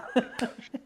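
short breathy laugh. Real, not acted. Laughing at himself while telling the host his story. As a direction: laugh it off at yourself.

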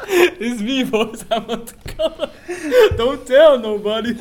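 hammed-up laughing boxer boast; laughing Rocky. Friend doing Rocky, laughing through his lines.

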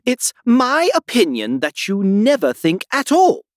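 high, loud, emphatic rebuke; severe, cutting put-down; rather severe. A narrator's reading. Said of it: "in a rather severe tone".

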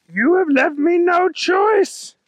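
high, loud, drawn-out bunny hero yell; yelled declaration. A narrator's reading. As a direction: yell it slowly as a threat. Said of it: "yelled Kyle".

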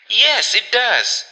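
high, slow, horrified assent; horror-stricken. A narrator's reading. Missus Brigham assenting to her sister, horror-stricken. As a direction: agree slowly, with a touch of horror. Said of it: "whose horror-stricken tone matched her sister's".

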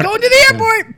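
high robot car voice. Pretend. Playing the car announcement.

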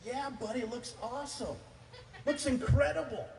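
high-pitched, gushing encouragement; gushing dad praise. Retold or imitated. Dad admiring his sons' Play-Doh creation, hammed-up enthusiasm. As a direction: overdo the praise for a small child.

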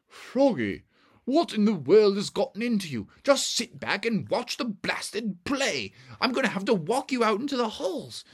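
put-on exasperated parent reining in a fidgety child; scolding dad. Dad scolds Froggy during the play, exasperated.

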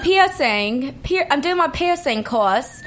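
put-on light Australian accent, "piercing" as "peesing"; imitating an Aussie friend. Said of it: "how does she pronounce piercing?".

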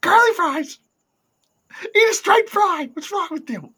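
hammed-up impression of a friend, yelling high; impression of co-host. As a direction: rant about curly fries in a mocking cartoonish squeal. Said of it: "this your Brian Last impression".

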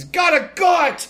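mock-indignant high cry; hammed-up exclamation. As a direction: blurt it out like a sketch character. Performs the character's catchphrase.

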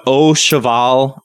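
Au Cheval said slowly, oh shuh-VAL; slow, careful Au Cheval to check it; careful pronunciation. Shown as a demonstration. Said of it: "am I saying that wrong".

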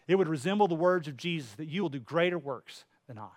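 ordinary talk

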